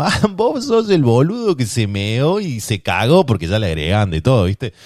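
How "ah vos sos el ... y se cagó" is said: voz aguda y lenta de burla; burla imitada. Retold or imitated. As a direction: imitar al compañero que se burla. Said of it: "Era como". (burla exagerada, aguda y lenta)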